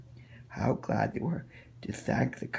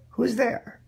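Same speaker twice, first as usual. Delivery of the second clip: put-on high, thin old granny calling out; a frail old woman asking who knocks; old grandmother's voice; 13 semitones higher, 6 dB louder than usual. Grandmother in bed calls to the door, high and old.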